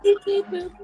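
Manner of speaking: high robotic beeping. Retold or imitated. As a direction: beep like a little robot. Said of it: "I was just doing what you do".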